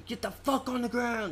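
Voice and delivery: high, barked cop order; game cop yelling. Retold or imitated. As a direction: yell it like a video-game cop.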